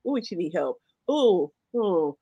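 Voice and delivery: a low pitying tsk of a reaction; mock concern. Cohost imitating her own reaction to worse clips, hushed pity. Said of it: "I'm like".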